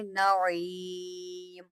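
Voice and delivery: नईम drawn out very slowly, the ee held; clear ee sound. Shown as a demonstration. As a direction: stretch the ee in नईम. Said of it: "यहाँ पर आप आई निकालेंगे".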